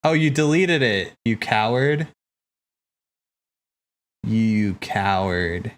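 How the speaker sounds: ordinary delivery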